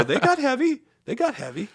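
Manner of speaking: high mocking repeat of his words; teasing. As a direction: throw his line back at him. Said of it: "And I go".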